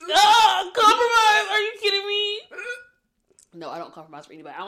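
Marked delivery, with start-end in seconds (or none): 0.1-2.4 high, loud mock indignation; mock outrage. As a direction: scoff at the very idea.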